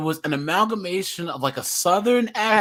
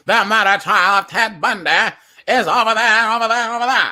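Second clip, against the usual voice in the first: put-on loud old-time mid-Atlantic newsreader; higher, louder mock mid-Atlantic news delivery; mid-Atlantic accent; 5 semitones higher, 8 dB louder than usual. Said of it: "this mid-Atlant-, Atlantic accent".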